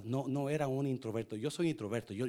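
usual speaking voice

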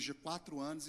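ordinary delivery

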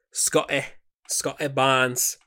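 put-on drawn-out British-accented name, slow; mock British announcer saying the name. A host playfully saying the player's name in a British accent. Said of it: "why I always say that in a British accent".

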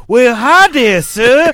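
high, loud Southern caricature; hammed-up movie villain trying to be polite; Percy. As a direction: put on an awkward, overdone niceness. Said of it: "you know in The Green Mile".

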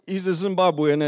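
normal talking voice

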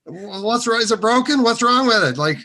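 high, loud, laughing confusion; mock bewildered questioning; baffled young self. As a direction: ask it like a clueless kid. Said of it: "And I said".

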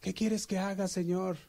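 high begging plea; pleading. Retold or imitated. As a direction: beg to be saved. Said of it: "Le dice".

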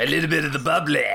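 weird drunk wrestler impression, quick; Chris Jericho. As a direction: slur it lightly like a tipsy wrestler. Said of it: "like weird drunk Chris Jericho".

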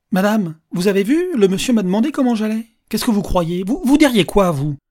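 high, loud, insistent questioning; theatrical old man seeking a witness; loud public appeal. Acted. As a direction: buttonhole a stranger for her opinion. Old man accosts woman before carriage.

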